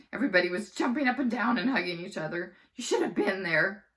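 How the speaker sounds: plain reading voice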